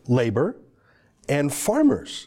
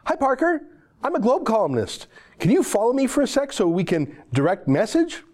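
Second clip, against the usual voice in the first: mock columnist voice; 8 semitones higher than usual. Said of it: "and she says".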